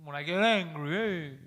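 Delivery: low teasing copy, drawn out at the end; mocking imitation. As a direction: parrot the friend back in a low mock voice. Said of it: "Did, did I really just say it like that?".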